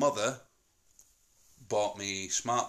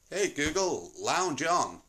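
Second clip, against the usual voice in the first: higher-pitched call to a smart speaker; voice command; 5 semitones higher, slower than usual. Shown as a demonstration. Dad instructing the smart speaker, commanding. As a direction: summon the device with falling phrase ends.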